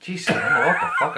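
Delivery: loud, high laughing outburst; shocked laugh. Real, not acted. Shocked laughing reaction to makeup.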